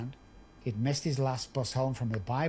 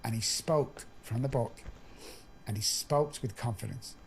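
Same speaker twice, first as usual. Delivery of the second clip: ordinary talk, nothing marked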